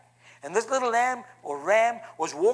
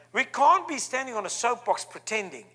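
ordinary delivery

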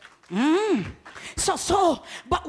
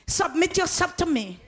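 normal talking voice

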